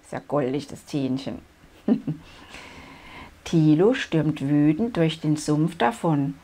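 plain reading voice

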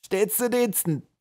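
high, slow character voice; eager henchman. A narrator's reading. Toothless hammerhead answers the general, eager to serve. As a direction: answer obligingly in a high drawn-out voice.

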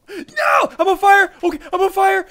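high frantic shouts; panicked yelling. Real, not acted. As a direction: scream it like you're burning. Burning in lava, panicking.

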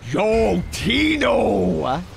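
drawn-out shouted greeting; calling out. Real, not acted. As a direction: hail a new member with a shout. Hails a brand new member.

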